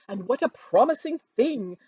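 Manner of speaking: boasting Mrs Bennet. A narrator's reading. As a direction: crow over the rich match to everyone. Mrs Bennet voiced in story.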